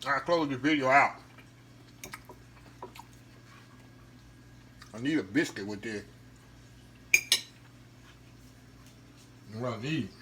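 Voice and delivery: talking while chewing; words round a bite; mouth full. Real, not acted. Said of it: "I don't wanna talk with my mouth full".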